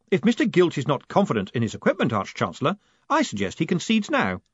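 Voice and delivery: fast, lilting mock of rival; mocking echo. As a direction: throw his words back at him. Moist mocks Gilt's line back.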